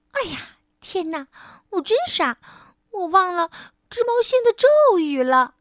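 high little mouse scolding itself; exasperated oh-no moment; dismayed realisation. Acted. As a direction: rise to a loud self-reproach. Mouse realises its forgotten spell.

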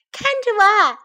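very high, loud cub's call; playful shout. A narrator's reading. Baby bear showing off in the leaves, high shout. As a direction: shout out for everyone to look. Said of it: "he shouted".

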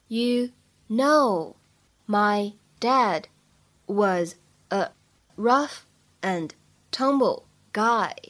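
slow, separated words with clear pauses; careful teacher's slow model reading. Shown as a demonstration. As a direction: dictate it slowly, one small chunk at a time. Teacher reads it word by word.

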